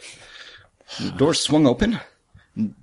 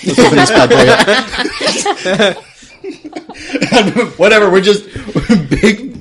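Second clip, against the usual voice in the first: laughing; 5 semitones higher, 8 dB louder, slower than usual. Real, not acted. Laughing, rallying the posse.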